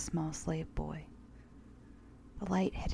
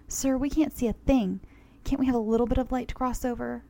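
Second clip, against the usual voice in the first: higher, louder anxious woman; worried woman; 6 semitones higher, 5 dB louder than usual. Acted. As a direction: plead for light, a bit raised. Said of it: "a woman's voice said".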